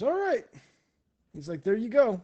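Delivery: high-pitched, loud sign-off; boisterous okay; hearty approval. Acted. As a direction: send them off cheerfully. Kung Po wraps up orders.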